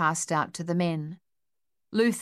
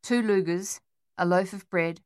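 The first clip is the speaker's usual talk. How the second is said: usual speaking voice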